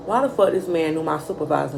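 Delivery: usual speaking voice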